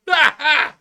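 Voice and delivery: high, loud fake laugh; mock guffaw. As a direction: ham up a belly laugh.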